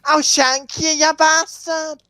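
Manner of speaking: high-pitched mock bully voice. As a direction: put on a high voice, picking a fight. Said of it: "Let's try and get an Ed voice".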